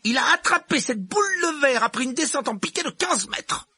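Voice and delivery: high-pitched strict teacher, firm and proud; teacher presenting a gifted pupil, strict and pleased; stern professor McGonagall. Acted. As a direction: play the brisk headmistress type. McGonagall voice again.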